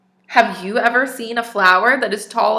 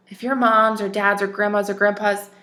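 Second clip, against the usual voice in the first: ordinary delivery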